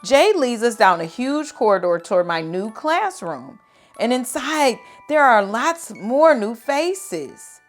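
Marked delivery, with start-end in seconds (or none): none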